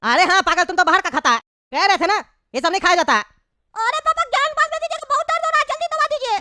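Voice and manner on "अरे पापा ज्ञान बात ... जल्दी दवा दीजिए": put-on high-pitched whining child voice; squeaky kid complaining of a bellyache; high, plaintive cartoon child; little boy in pain. Child in pain begs for medicine.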